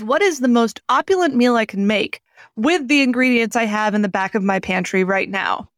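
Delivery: ordinary delivery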